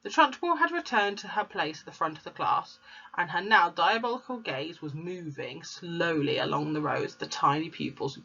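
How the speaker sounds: ordinary delivery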